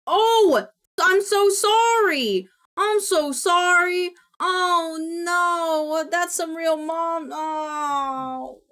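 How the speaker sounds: real loud, high, drawn-out wail of apology; distraught apology. Real, not acted. Streamer mortified at mixing up two viewers' names, distraught apology. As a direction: cry out sorry, stretched and mortified. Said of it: "I feel so bad".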